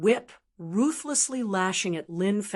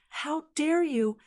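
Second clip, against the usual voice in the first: higher and slow, outraged challenge; furious shout; 7 semitones higher than usual. Acted. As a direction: demand it, indignant and drawn out. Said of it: "he shouted furiously".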